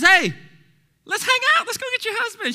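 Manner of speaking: very high, loud buddy invitation; hammed-up chummy call to hang out; squeaky, over-eager casual paraphrase; modern casual vernacular. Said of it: "in our modern day vernacular".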